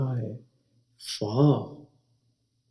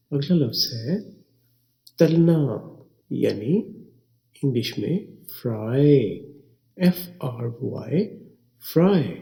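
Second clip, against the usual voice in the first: ordinary delivery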